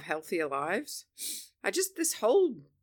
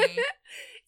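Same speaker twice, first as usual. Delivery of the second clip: high giggle. Real, not acted.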